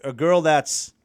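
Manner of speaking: normal talking voice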